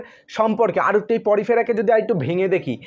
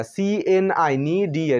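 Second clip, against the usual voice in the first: normal talking voice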